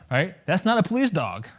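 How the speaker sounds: normal talking voice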